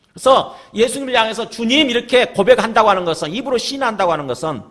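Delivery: impassioned pulpit delivery; full-force preaching. Real, not acted. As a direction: preach it out to the congregation.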